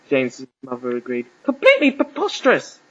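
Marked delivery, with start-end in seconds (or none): 1.4-2.8 high, loud outburst; indignant. A narrator's reading. Mother agreeing the wedding makes no sense, indignant. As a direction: huff it out, pitched way up.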